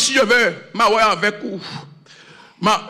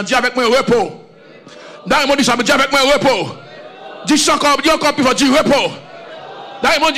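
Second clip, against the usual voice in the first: fervent call and response; 5 semitones higher than usual. Acted. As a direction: whip up the congregation to repeat after you. Call and response, rising fervour. (prédicateur qui fait répéter la foule, de plus en plus fort)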